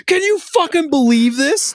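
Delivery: loud, high, incredulous dad imitation; calling out. Dad complaining to mom about the crying, calling out. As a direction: holler it to another room in mock outrage. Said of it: "calling out to my mom".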